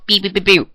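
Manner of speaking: high mimicked keypad beeps; imitated phone beeps. Demonstrating calls on a house phone, imitated beeps. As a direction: beep like a phone being dialled.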